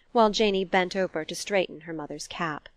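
ordinary delivery, nothing marked

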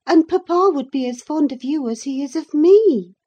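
put-on high, loud, emphatic girl's voice; reasoning young Catherine. A narrator's reading. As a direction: argue your point like an earnest girl.

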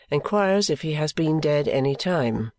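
usual speaking voice

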